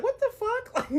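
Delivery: high, laughing what the fuck; incredulous. Retold or imitated. Answering the coworker who called her fat, shrill laughing disbelief.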